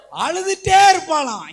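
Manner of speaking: full-force pulpit cry on அழுதுட்டே; preaching shout. Acted. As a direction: hammer the line home to the congregation.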